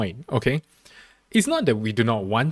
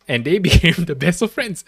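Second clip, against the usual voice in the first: ordinary talk, nothing marked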